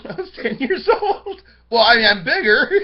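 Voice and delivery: loud, high, laughing words; laughing while talking. Real, not acted. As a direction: burst out laughing through the sentence. Laughs at own comparison.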